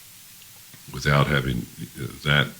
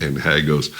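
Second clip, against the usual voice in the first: normal talking voice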